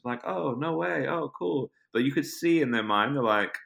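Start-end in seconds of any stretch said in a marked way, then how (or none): 0.2-1.7 high-pitched imitation of polite amazement; put-on, high "how nice" reaction; mock pleasant surprise. Acting out casting people's polite reaction.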